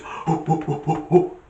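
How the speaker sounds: loud, breathy ape hoots; punchy string of gorilla hoots; gorilla hooting. Retold or imitated. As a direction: imitate a silverback hooting at a visitor. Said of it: "he goes".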